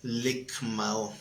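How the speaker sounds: "Ligmao" said softly as lig-MA-o with the stress on the A; a quiet second try at "Ligmao", stressed on the A; Greek word ligmao. Shown as a demonstration. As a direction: repeat "Ligmao" gently, leaning on the A. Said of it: "Acento en la A".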